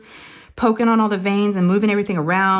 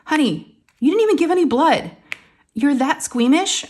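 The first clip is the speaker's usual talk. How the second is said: higher-pitched imitation of a disbelieving spouse; incredulous husband; 6 semitones higher than usual. Husband hearing the blood draw story, incredulous. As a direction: question her in mock disbelief, pitch lifted.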